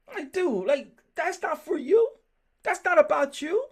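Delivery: getting fired up over the point; worked up. Real, not acted. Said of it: "I'm getting worked up already".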